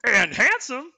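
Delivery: high, loud put-on reindeer voice; Sven. A narrator's reading. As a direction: chime in as a talking animal. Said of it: "Kristoff said in Sven's voice".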